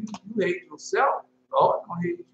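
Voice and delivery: normal talking voice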